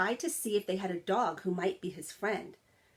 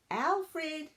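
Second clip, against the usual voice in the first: much higher call across the yard; 9 semitones higher than usual. A narrator's reading. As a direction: call for a hidden friend. Rex calls out searching.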